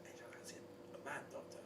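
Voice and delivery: unvoiced, secretive whisper; whispering an awkward request at the desk. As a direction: murmur it so nobody hears. Embarrassed whispered request at reception.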